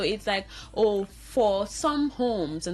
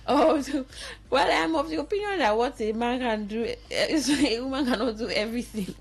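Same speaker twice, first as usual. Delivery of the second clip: laughing; 5 semitones higher than usual. Real, not acted. Host laughing as she gives her opinion on women's power, laughing. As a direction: giggle through every word.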